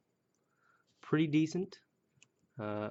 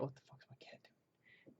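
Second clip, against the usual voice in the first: hushed aside under the breath; startled whispered aside; 20 dB quieter than usual. Real, not acted. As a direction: drop to a whisper, caught off guard. Aside about cat interrupting.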